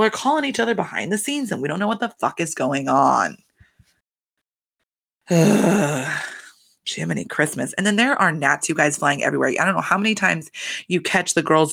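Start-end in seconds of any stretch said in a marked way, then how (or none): 5.3-6.4 long, tired sigh; weary sigh. Real, not acted. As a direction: sigh it out, fed up. Weary about the cast's behavior.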